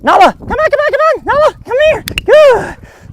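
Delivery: very high, loud, squealing dog call; excited recall. Shown as a demonstration. Trainer calling the dog away from sniffing, excited. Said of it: "then call away from that".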